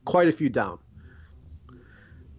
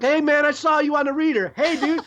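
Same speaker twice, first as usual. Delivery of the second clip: excited fan; 11 semitones higher than usual. Retold or imitated. Voicing fans on the street.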